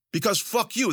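high-pitched angry comeback; defiant refusal. A narrator's reading. Captive refusing to apologize to Zoot, defiant.

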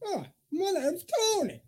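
high-pitched little character voice; Tony puppet voice. Pretend. Puppeteer introducing his character to the podcast, high character voice. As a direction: pipe up as a tiny puppet. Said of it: "[name], you got a voice yet?".

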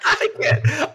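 laughing too hard to go on. Real, not acted. Cracking up while trying the accent. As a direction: break up mid-line.

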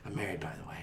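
whispered. Acted. Dying aside.

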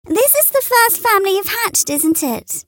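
high hen voice asking; high hen inquiring. A narrator's reading. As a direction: ask sweetly. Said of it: "Polly Plymouth Rock inquired".

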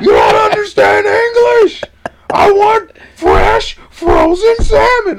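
slow, high-pitched imitation shout; yelling customer. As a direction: yell each word as the irate customer. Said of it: "He's yelling".

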